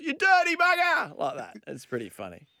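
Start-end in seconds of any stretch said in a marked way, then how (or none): 0.0-1.2 high-pitched mock shout; shouted heckle. Teller re-enacts heckling nudists from the boat, mock shout. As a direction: yell it out as a jeer from a passing boat. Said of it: "we shout out stuff like".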